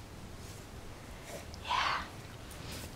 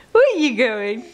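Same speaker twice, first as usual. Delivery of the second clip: ordinary delivery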